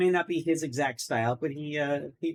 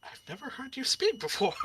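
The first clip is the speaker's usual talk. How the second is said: surprised; 9 semitones higher, 5 dB quieter than usual. Acted. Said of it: "and he's like".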